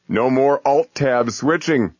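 high, slow momentous proclamation; grand announcement. Retold or imitated. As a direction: announce it like a miracle cure. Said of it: "in the tone of voice you might use to announce a cure for cancer".

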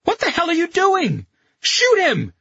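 very high, loud yelled demand; exasperated cry. A narrator's reading. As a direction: yell in frantic exasperation. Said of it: "Carletto cried".

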